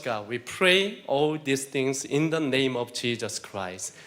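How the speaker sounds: slow, soft earnest prayer. Acted. Preacher praying softly to the Lord, slow and hushed. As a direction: pray it quietly and slowly, addressing God. Closing prayer before the sermon.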